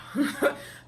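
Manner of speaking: short unvoiced laugh, high and breathy. Real, not acted. Recapping the show, she laughs at her own line: breathy laugh. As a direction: let a quick breathy laugh slip out.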